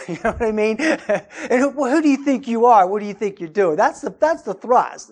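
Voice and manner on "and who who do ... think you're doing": loud, higher-pitched indignant demand; imitating an affronted interrogator; confrontational challenge. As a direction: challenge them as if they have no right. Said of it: "The tone and the attitude is like".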